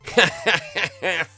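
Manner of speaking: high, loud cackle; gleeful cackle. Retold or imitated. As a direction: cackle with glee. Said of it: "I'm like".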